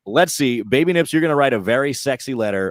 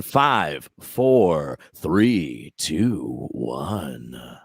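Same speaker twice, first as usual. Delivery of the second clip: sinking countdown; 10 semitones lower, much slower than usual. Pretend. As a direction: count down slowly, sinking to a low hush. Counting down into a sexy letter.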